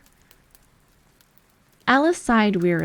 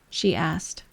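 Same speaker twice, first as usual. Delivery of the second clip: usual speaking voice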